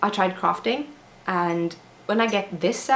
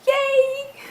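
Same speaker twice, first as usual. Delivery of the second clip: high squealy cheer; excited yay. Retold or imitated. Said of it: "I just say".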